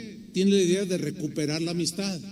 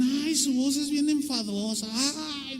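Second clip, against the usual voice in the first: lightly voiced whiny complaint, pitched up; annoyed churchgoer; 6 semitones higher than usual. A churchgoer gripes about the pastor's voice, mild whiny mimicry. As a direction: mimic a fed-up listener griping. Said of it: "Otros decían".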